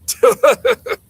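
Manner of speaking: high burst of laughter; loud laugh. Real, not acted. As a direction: crack up loudly. Laughs at own impression.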